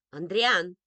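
loud, strict summons; commanding motherly call; stern mother. A narrator's reading. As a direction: order a child back firmly. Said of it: "строгим голосом позвала мама".

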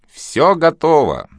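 slow, loud host's call. Acted. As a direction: announce it slowly and loud. Host calling out.

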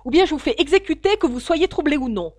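loud, high, threatening; a furious royal ultimatum; angry king. A narrator's reading. The king threatens the troubled hatter with execution, angrily. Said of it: "répéta le roi en colère".

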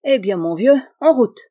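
put-on loud, eager old man; a loud, eager call to set off; rousing call. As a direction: whip him up to set off at once. Old man rallies the narrator.

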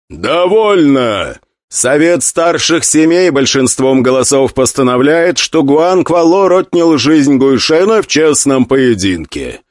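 slow, loud formal verdict; loud proclamation. Acted. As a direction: pronounce the ruling with authority. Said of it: "громко произнёс Гуан Юй".

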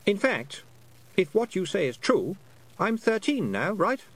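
put-on high, lightly boyish voice, puzzled; a lost boy working out how long he's been away; young boy Roland, unsure. A narrator's reading. Lost boy telling Tiffany about time, high and puzzled. Boy works out his age.

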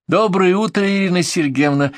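high chirpy sugary greeting; cartoon princess. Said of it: "прощебетала у двери".

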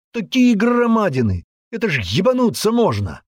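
high, heated insistence; incredulous man. Acted. Man arguing with the girl about the trees, incredulous heat. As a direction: argue with rising amazement at the giants.

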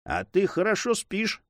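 high, lightly teasing remark; mocking. A narrator's reading. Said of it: "обратился к нему Дон Фернандо насмешливо".

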